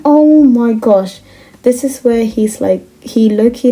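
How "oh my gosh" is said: loud, drawn-out appalled exclamation. Real, not acted. Storyteller reacting to what the boyfriend says, appalled. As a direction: build up to the outrage, aghast.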